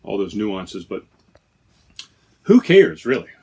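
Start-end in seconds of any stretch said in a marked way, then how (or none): 2.4-3.3 who cares shouted out high and loud, brushing it off; a big dismissive who cares; loud shrug. Acted. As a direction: toss it off loudly, who cares. Shrugging off the glass issue.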